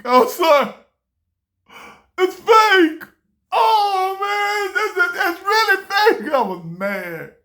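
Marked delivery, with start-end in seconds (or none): 2.2-6.3 much higher, loud disbelieving cry; like the floor just dropped out; shocked dismay. Retold or imitated. Young guy at the pawn shop, shocked the gold is fake.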